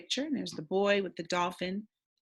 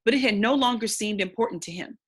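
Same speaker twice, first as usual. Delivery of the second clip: steady, expressive read-aloud narration; story narration; 3 semitones higher, 4 dB louder than usual. Acted. Teacher reading the novel to the class, storytelling narration. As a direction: read the chapter aloud to young listeners.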